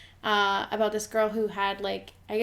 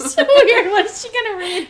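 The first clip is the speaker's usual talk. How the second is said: high, loud, through giggles; squealed question amid laughter; laughing bafflement; 9 semitones higher, 10 dB louder than usual. Retold or imitated. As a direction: exclaim it while cracking up. Retelling own reaction, still laughing.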